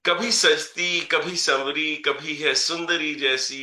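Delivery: measured, rhythmic reading of verse; poem recitation. Acted. Poet reciting a poem about his mother to the audience, measured recitation. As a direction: recite each line and let it land.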